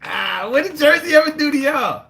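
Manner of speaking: loud, high comic protest; hammed-up high-pitched complaint; mock outraged wail. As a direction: wail it like you're wounded for Jersey. Mock protest on behalf of Jersey.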